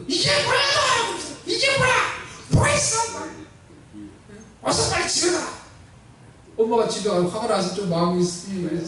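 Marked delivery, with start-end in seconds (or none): 0.1-3.0 very high-pitched mock scolding; angry mother. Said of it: "막 화를 내는 거예요". 4.6-5.5 put-on high, cross order to a child; angry mother. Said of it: "막 화를 내는 거예요".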